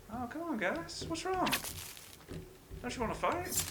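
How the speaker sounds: high-pitched mock coaxing; taunting goad. Player baits cannibals onto traps, taunting. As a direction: goad them in a high, teasing voice.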